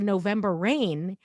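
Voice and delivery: usual speaking voice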